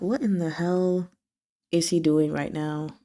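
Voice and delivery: softly voiced, exasperated aside; quiet disbelief. [name] reacting to the taunt, quietly exasperated. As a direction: say it low, under your breath.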